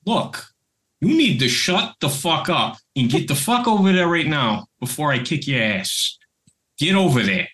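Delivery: furious Brooklyn Italian warning; angry Brooklyn threat. Retold or imitated. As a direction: threaten him in a thick Brooklyn accent. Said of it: "Brooklyn accent".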